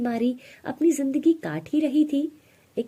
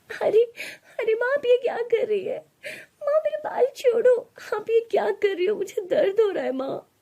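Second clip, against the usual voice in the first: sobbing out in pain, pleading; pained crying plea. Acted. Radha dragged by her hair.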